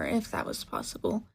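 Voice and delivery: plain reading voice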